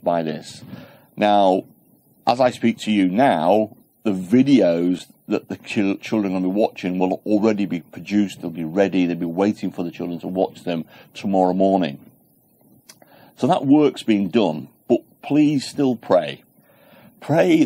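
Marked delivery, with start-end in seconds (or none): none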